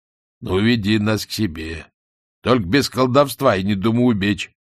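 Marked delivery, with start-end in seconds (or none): none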